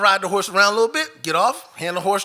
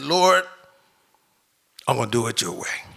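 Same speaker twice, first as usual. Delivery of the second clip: crying; 7 semitones lower, 3 dB quieter than usual. Retold or imitated. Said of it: "I just break down crying like a baby".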